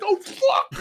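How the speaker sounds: high, loud panicked shout; mock panic. Customer grabbed by security, mock panicked shout. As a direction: yell it out as if suddenly grabbed.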